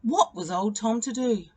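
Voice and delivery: ordinary delivery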